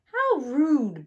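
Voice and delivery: offended huff; belching. A narrator's reading. Cement mixer belching indignantly at bulldozer. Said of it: "belched Cement Mixer".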